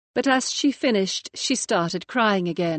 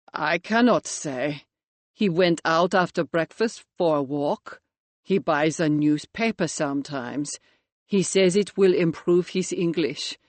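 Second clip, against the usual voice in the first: lightly voiced Eastern European accent, lower; a hint of a Latvian accent, evasive; 4 semitones lower than usual. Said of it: "Georg spoke with a pronounced Eastern European accent".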